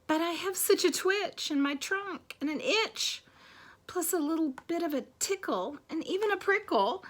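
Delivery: high, fretful storybook elephant; apologetic elephant, about to sneeze. Acted. Elephant warning friends of the coming sneeze, fretful. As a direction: voice rising as the sneeze comes on.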